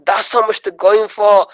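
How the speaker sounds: high, slow amazed mock voice; gullible viewer. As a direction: gasp at the price like a fool.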